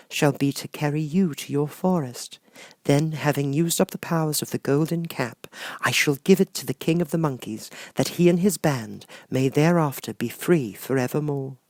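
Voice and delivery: high, gentle sorceress voice; kind witch Glinda. Acted. Glinda promising Dorothy a way home, high and gentle. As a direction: soothe the child like a kind fairy.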